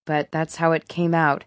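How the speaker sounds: ordinary delivery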